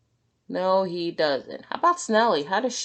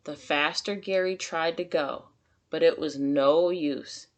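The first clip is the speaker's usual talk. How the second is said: plain reading voice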